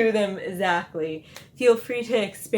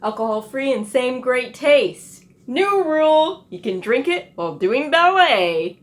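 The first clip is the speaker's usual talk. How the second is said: high, loud, bubbly commercial pitch; excited ad voice; 6 semitones higher, 8 dB louder than usual. Acted. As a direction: sell it like a peppy TV ad. Cheerful drink ad skit.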